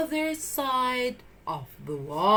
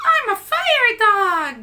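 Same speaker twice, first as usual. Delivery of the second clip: high, loud triumphant pup; puppy riding the fire truck; proud puppy; 12 semitones higher, 8 dB louder than usual. Acted. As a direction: crow with pride.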